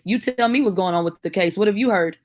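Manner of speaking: ordinary delivery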